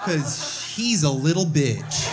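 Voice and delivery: hammed-up aggressive mom, rising to a loud taunt; mock-bully him like a tipsy mother; mocking drunk mom. Drunk mom taunting her son, hammed-up shouty mockery. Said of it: "She'll be like".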